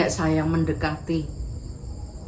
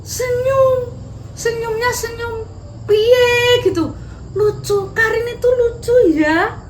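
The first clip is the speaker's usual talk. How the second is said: delighted smile; 5 dB louder than usual. Acted. Narrator acting Dinda's smile.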